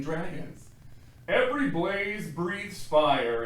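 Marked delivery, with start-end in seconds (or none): 1.3-3.4 dragon father voice. Acted. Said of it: "explains his father".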